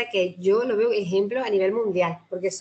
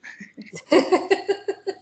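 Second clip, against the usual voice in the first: high bright laugh; 10 semitones higher than usual. Real, not acted. Laughs after S1's speech.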